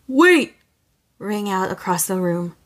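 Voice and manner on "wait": loud, higher, urgent cry; panicked call. Acted. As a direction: call her back in a panic. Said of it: "a nearly panicked".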